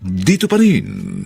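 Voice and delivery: low, slow announcer read; radio announcer. Acted. The host invites listeners to the final chapter, announcer voice. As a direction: invite listeners to the next episode.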